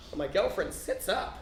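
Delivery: ordinary delivery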